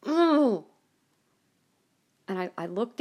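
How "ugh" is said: loud, higher groan of disgust; disgusted ugh. Retold or imitated. Fed up with the news, loud disgusted groan. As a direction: let out a sick groan. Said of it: "I was like".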